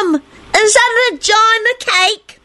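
put-on very high, drawn-out child's shriek. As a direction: shriek the question slowly, scandalised. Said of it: "Nicholas shrieked".

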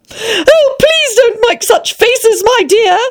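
put-on high-pitched girl's exclamation; Alice crying out. A narrator's reading. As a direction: plead in a high little-girl voice. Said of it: "she cried out".

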